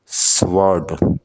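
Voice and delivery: Sword drawn out slowly as one beat; slow syllable demo. Shown as a demonstration. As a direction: hold sword long for the class. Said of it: "sword में एक syllable". ("Sword" धीरे, खींचकर)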